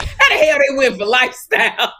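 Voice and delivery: mock-indignant, laughing; laughing mock outrage. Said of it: "And they was like".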